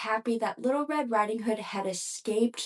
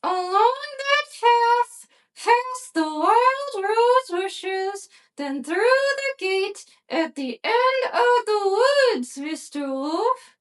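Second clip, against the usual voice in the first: put-on high, slow, sweet little girl; 12 semitones higher, slower than usual. A narrator's reading. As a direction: answer innocently in a high little girl's voice. Girl gives directions.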